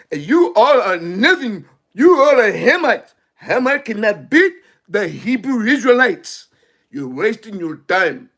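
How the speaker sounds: stately, solemn King James delivery; formal biblical voice. Retold or imitated. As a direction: proclaim it like a biblical elder. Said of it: "He'd have passed like this".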